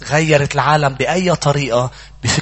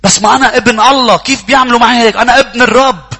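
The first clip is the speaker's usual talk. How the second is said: indignant outburst; 10 semitones higher, 10 dB louder than usual. Acted. Voicing a believer claiming privilege.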